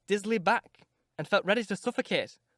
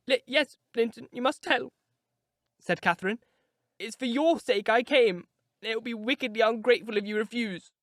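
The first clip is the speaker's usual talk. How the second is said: put-on higher, urgent girlish plea; pleading young Catherine; 5 semitones higher than usual. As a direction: beg him to tell.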